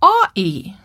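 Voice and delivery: "Aw-ee" as doggy with the consonants dropped; toddler's vowels only. Retold or imitated. Toddler pointing at an animal, "Aw-ee" with no consonants. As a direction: say "Aw-ee", just the two vowels, falling at the end. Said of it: "he says".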